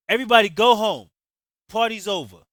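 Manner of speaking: loud, high furious command; angry order. Acted. Javon sending guests home from the stairs, loud angry order. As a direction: end the party with fury.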